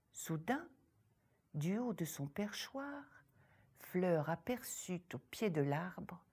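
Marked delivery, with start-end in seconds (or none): none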